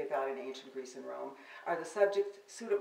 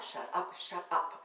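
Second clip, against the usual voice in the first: whispered shut up; 3 semitones higher, slower than usual. Acted. Poet telling off the man in her poem, fading to a whisper. Repeated shut up dying away.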